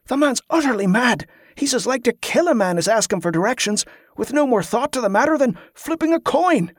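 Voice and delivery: pitched high in disbelief; exasperated. Acted. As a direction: voice climbing with outrage. Oric's exasperated outburst about Cabot.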